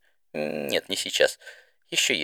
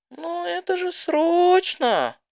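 squeaky slow protest; 3 dB louder, much slower than usual. Acted. Didi protests the letter is urgent.

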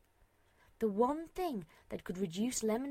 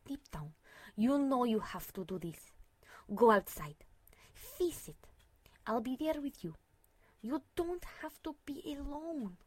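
tender whisper; 3 semitones higher than usual. A narrator's reading. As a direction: gently whisper comfort.

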